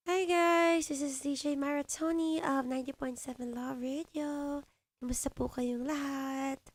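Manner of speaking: a high, cutesy DJ greeting; high squeaky voice. Shown as a demonstration. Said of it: "Yung matinis yung boses?". (mataas at matinis na boses)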